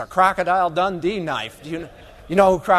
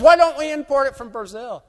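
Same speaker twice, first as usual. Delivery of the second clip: higher, louder imitated protest; mock indignant question; 8 semitones higher, 5 dB louder than usual. Beef producer protesting at the speaker, hammed-up indignation. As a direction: play the irate rancher lightly. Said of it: "he got all violent".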